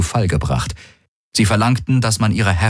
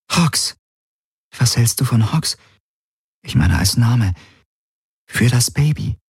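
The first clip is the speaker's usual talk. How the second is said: put-on higher, tentative young woman; gently suggesting, higher; 6 semitones higher than usual. Kirai suggests the baby's name.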